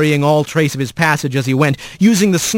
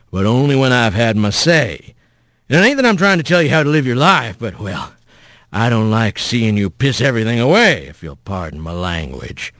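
low folksy rumble, softening to the end; folksy rough voice; 7 semitones lower than usual. Acted. Mysterious voice has his say to Rat, low and folksy. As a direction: counsel him like a backwoods elder.

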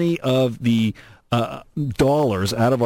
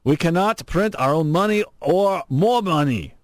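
higher-pitched, hammed-up Austrian accent; mock-Austrian politician impression; bad Austrian accent; 3 semitones higher than usual. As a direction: mimic the governor's speech in a bad Austrian accent. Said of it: "it's my bad accent".

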